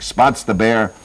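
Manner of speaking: ordinary talk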